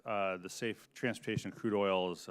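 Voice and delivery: ordinary talk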